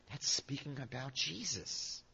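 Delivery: hushed, slow, reverent retelling; tremendous reverence. Retold or imitated. Missionary answers who the verse means, with tremendous reverence. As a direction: say it softly and slowly, in awe. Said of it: "she said with tremendous reverence".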